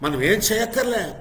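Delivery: high, loud preacher's call; rally the congregation; full-force preaching. Acted.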